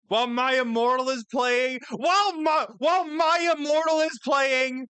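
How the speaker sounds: exasperated. Real, not acted. Incredulous at the music.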